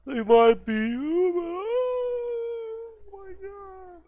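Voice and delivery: mock-spooked drawn-out wail; hammed-up horror. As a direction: stretch it out like a horror movie gasp. Eerie repeat of the phrase.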